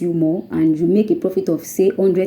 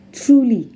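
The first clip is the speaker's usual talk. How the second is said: single out "truly" with a higher, firm fall; stress on truly; 5 semitones higher than usual. Real, not acted. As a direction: TRULY set apart, high then falling. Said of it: "We are laying emphasis on the word truly".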